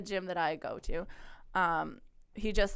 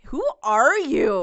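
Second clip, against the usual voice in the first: loud, drawn-out, incredulous retort; indignant; 5 semitones higher, 10 dB louder, much slower than usual. Retold or imitated. Retelling retort to condescending gym guy, indignant. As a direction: stretch it out in high, loud disbelief.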